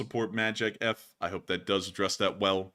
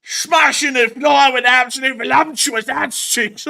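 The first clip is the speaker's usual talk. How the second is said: much higher, louder triumphant bellow; mock battle shout; 13 semitones higher, 12 dB louder than usual. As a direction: roar it like a victorious brawler. Answering as the Enforcer.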